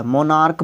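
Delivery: मोनार्क with a final K sound; hard K ending. Shown as a demonstration. Teacher confirms the pronunciation for students, मोनार्क with final K. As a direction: finish मोनार्क on K, not CH. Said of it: "क होती है".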